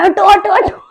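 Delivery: high, loud mock command; urgent shooing. Grandmother shooing everyone from the child, high urgent call. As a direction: call out like a grandmother clearing the crowd. Acts out grandmother clearing people away.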